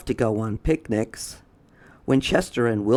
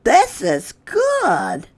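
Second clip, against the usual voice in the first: very high, slow, louder character voice; Chester's voice; 14 semitones higher, 5 dB louder, slower than usual. Acted. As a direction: draw it out way up high. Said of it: "said Chester".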